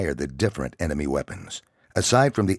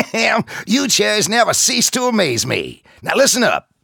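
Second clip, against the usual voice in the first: higher, louder amused sergeant; gruff drill sergeant; 8 dB louder than usual. Acted. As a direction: bark it, still half laughing. Sergeant laughing at recruits.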